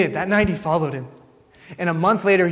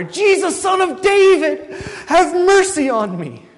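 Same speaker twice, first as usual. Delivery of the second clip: full-voiced shout, very high and drawn out; desperate crying out; 13 semitones higher, 8 dB louder, slower than usual. Preacher as blind Bartimaeus refusing to be hushed, crying out louder. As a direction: yell for mercy as loud as you can, stretching the words. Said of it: "keeps crying out louder and louder".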